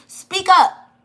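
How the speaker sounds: a sharp call to speak up; firm command. Acted. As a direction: bark the order for Spirit. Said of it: "So Spirit is saying".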